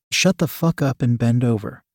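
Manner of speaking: ordinary delivery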